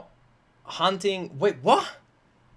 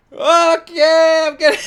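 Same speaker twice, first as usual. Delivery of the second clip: louder, higher mimicking of the prince; mock prince reaction; 5 semitones higher, 7 dB louder, slower than usual. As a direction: act out his sudden face change. Imitating prince's face change.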